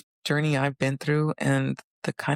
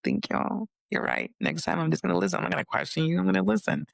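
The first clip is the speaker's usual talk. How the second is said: amazed thanks; 6 semitones higher than usual. Retold or imitated. Speaker thanking her inner voice after needing the pen, high and amazed. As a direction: realize the voice was right. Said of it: "And I'm like".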